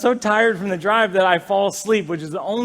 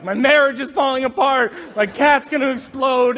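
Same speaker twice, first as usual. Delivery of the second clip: higher-pitched frantic mock wail; acted panic; 6 semitones higher, 4 dB louder than usual. Husband running round the house, mock-frantic. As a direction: call it out as comic alarm.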